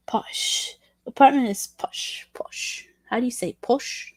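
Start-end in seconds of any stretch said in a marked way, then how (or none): 0.1-0.7 whispered word. Pretend. Streamer testing a British word in a whisper. 1.7-2.9 whispered word. Pretend. Streamer testing a British word in a whisper.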